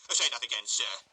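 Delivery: loud, outraged demand; indignant beadle. A narrator's reading. Beadle catching the couple, loud and outraged. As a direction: bristle with pompous outrage.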